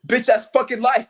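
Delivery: normal talking voice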